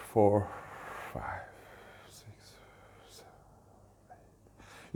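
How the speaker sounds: soft count fading into breath; counting while exhaling. Shown as a demonstration. Instructor demonstrating a long exhalation, counting while exhaling. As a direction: let each number sink away on the outgoing breath, down to unvoiced air.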